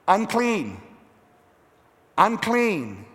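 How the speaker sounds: mildly acted cry of a shamed outcast; leper's shamed shout. Preacher voicing the leper warning others away, restrained shamed call. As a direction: call it out as a lightly voiced warning, word by word. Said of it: "the leper shouted in a loud voice".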